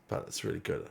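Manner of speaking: whispered aside. Real, not acted. Aside about favourite song.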